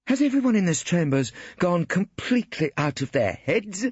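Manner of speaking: cool sarcasm from a female barrister; dry lady barrister. A narrator's reading.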